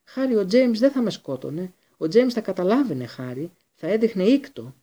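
high, loud desperate appeal; frantic wheedling coward; pleading. A narrator's reading. Pettigrew begs Harry for mercy.

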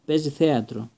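ordinary delivery

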